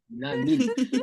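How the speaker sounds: light London roadman slang; lightly put-on roadman accent. Shown as a demonstration. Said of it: "trying to learn the roadman accent".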